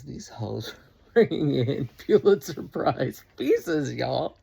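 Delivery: high, loud, laughing disbelief; amazed outburst. Real, not acted. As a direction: burst out loud and high in amused awe. Marvels at rivals' far better poems.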